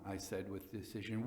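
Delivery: ordinary delivery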